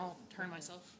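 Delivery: soft, quiet customer service voice; polite and soft-spoken. Real, not acted. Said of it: "I'm still using my customer service voice".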